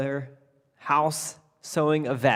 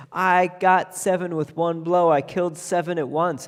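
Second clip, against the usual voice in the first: louder, higher proud announcement; proud boast; 5 semitones higher, 5 dB louder than usual. Acted. Tailor announcing his feat to the neighbours, proudly loud. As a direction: crow it to the whole street.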